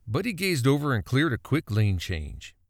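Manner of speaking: plain reading voice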